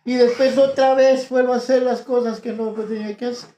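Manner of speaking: high, loud testimony preached full out; fervent preaching. Real, not acted.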